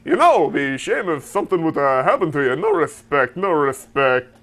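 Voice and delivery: Dangerfield-style mob threat, loud at end; Rodney Dangerfield hitman. Retold or imitated. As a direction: play a joking hitman with no respect. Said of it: "Rodney Dan- as a hitman".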